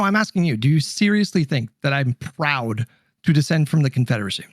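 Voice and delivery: ordinary talk, nothing marked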